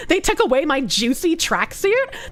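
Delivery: put-on melodramatic Valley girl with swooping pitch; hammed-up, traumatized Valley girl. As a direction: play a Valley girl recounting a horror story. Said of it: "replicate Kirsch's Valley Girl voice".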